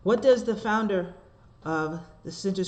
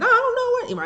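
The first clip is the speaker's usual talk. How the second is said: high, loud retold panic; panicked; 7 dB louder than usual. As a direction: wail it as a re-enacted moment of panic. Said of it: "I was just so panicky".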